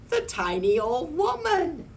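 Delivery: high, rising excitement on the reveal; amazed reveal. A narrator's reading. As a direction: deliver the big surprise high-pitched. Narrator reveals the surprise rescuer.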